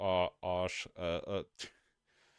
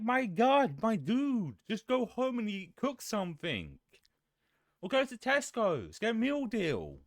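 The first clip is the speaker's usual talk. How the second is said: high-pitched, worked-up rant; exasperated plea; 11 semitones higher, 4 dB louder than usual. Retold or imitated. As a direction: plead with a hopeless customer in disbelief. Retold exasperated plea to customers.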